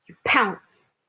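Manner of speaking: a hushed, breathy story whisper; dramatic whisper. A narrator's reading. Reading the hunt to the child in a whisper. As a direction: drop to a whisper for the pounce.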